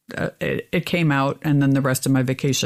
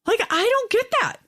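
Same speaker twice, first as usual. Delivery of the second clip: exasperated disbelief. Real, not acted. Outraged at people filming.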